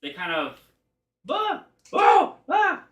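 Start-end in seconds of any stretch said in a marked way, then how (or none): 1.2-2.8 short, high, loud yelps; sharp burst of creature cries; startled creature yelps. Acted. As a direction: squawk in high-pitched alarm. GM voices startled little creatures.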